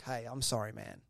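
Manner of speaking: low, soft apology; acted-out subdued sorry; imitated sheepish apology. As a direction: drop your voice and apologise, acting it.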